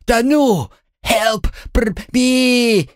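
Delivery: high choking cries for help; drowning panic. A narrator's reading. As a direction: scream while going under. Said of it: "завопил по-другому". (панический вопль тонущего)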